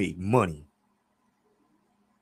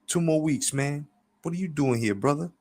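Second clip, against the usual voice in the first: higher-pitched friendly character voice; raised, chummy dialogue voice; Pops; 7 semitones higher than usual. A narrator's reading. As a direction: greet an old friend, pitched up. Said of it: "Pops replied".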